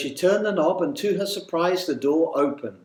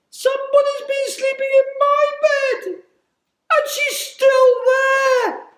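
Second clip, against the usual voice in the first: high piping bear voice; 7 dB louder than usual. A narrator's reading. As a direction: squeal it like a shocked tiny bear cub. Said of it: "high piping voice of the little tiny baby bear".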